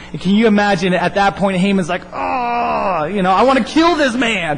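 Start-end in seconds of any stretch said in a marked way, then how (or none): 2.1-4.6 high, drawn-out mock wail of frustration; hammed-up seething, higher than usual; exasperated rage. Haman learns he must honor Mordecai, mock exasperated rage.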